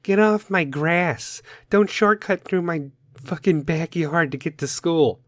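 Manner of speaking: mock crotchety homeowner; grumpy neighbor. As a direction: drag out a cranky get-off-my-lawn warning. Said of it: "that guy or that woman like".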